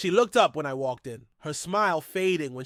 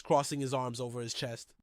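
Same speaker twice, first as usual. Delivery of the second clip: ordinary delivery, nothing marked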